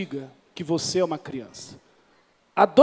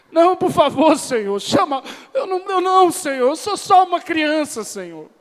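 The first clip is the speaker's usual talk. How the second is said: high, loud hammed-up whine; whiny pleading; 8 semitones higher, 4 dB louder than usual. Jeremiah whining excuses to God, high mock plea. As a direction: plead like a scared kid dodging a chore.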